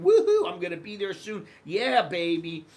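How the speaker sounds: loud whoop of joy, pitch up; whooping cheer. Retold or imitated. To himself, thrilled to be heading to Thailand, loud whooping. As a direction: cheer like you just got your ticket.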